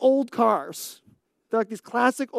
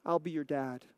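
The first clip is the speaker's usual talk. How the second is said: tender offer; 7 semitones lower, 11 dB quieter than usual. Retold or imitated. Miyagi's offer repeated.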